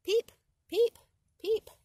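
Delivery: high, loud piping peeps; high frog peep. Acted. The spring peeper calling in the woods, high and loud. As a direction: chirp it like a tiny frog.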